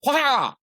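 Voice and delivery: sharp, loud, high retold yelp; mock teacher shout. Teacher calling the kid forward, a sharp mock shout. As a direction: burst out like a teacher startling the class. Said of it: "she'd say".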